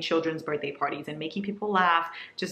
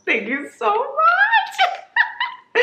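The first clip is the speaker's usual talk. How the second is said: very high, drawn-out thanks; singsong thanks; 6 dB louder, much slower than usual. Real, not acted. Thanking the sender in a long high singsong. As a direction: stretch the thanks into a high song.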